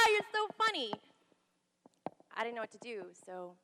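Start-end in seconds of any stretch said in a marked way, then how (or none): 0.1-0.9 high and loud, still laughing. Retold or imitated. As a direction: laugh through it to dodge him. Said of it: "looked at him and laughed".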